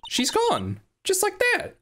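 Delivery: put-on high startled girl voice; surprised. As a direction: gasp it out, caught off guard. [name] reacting in the game.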